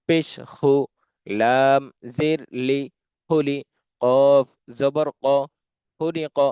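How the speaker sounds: slow, careful letter-by-letter spelling; slow spelling demo. Shown as a demonstration. Teacher spells Arabic letters for students, slow and careful. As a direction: spell it out slowly for beginners. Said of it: "যেমন".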